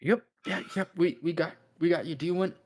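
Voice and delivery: high-pitched, hesitant escort voice; flustered underling. Acted. Escort asking the angry captain what to do, flustered.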